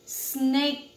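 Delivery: usual speaking voice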